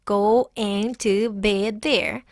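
"going to be" slowly spelled out syllable by syllable; very slow, full "go-ing to be"; four separate syllables. Shown as a demonstration. Teacher counting four syllables, slow separated "going to be". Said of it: "Instead of four syllables".

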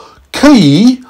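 high, slow squeaky Mandarin; meteorite speaking Chinese. A narrator's reading. Meteorite answers in Chinese, high squeak. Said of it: "replied first in Chinese".

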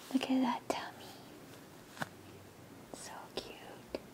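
soft hushed whisper; tender whisper. Real, not acted. Showing the doll's tummy, tender whisper. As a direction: whisper it lovingly.

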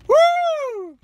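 very high happy woo; excited whoop. Real, not acted. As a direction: cheer at the bite. Caught something.

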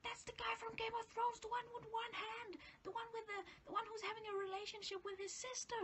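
high, breathless whisper to a friend; urgent whisper. Retold or imitated. Whispering urgently to her mate about the actor nearby. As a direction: hiss it urgently so the celebrity won't hear. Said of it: "quickly punched my mate in the shoulder".

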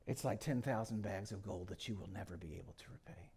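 hushed, weighty whisper. Real, not acted.

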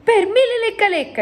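high-pitched giant's outburst; astonished exclamation. A narrator's reading. Giant astonished by the ballroom, exclaiming high. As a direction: burst out in amazement, pitched right up. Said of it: "esclamò".

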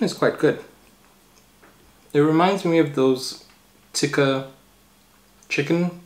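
speaking through fingers; pondering with hand on chin; hand over mouth. Real, not acted. Seen 0: Fingers rest over lips and chin throughout, thinking pose.

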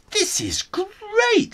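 drawn-out high delighted praise; excited exclaim. Acted. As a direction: gush over the food. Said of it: "Simon exclaimed".

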